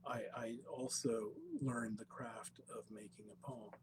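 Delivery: hushed whisper. Real, not acted. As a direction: drop to a whisper. Intimate confession before poem.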